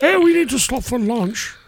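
squeaky cartoonish call; hammed-up high-pitched whine; goofy high voice. Joking voice for boatman.

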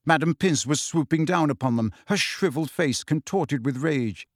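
ordinary delivery, nothing marked

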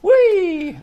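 high, loud whoop of joy; excited cheer. Real, not acted. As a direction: cheer like you just won. Cheering the news.

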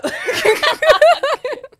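high shrieking burst of laughter; sudden shrieking laugh; loud laugh. Real, not acted.

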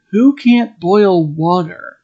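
ordinary talk, nothing marked

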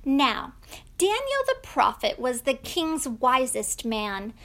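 plain reading voice

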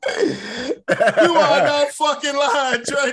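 slow laughing words, high and loud; laughing hard. Real, not acted. Cohost laughing hard at the story. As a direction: crack up while agreeing.

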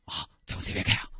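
urgent hushed whisper. Acted. Girl hushing the grumpy old man, urgent whisper. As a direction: hush him so nobody hears.

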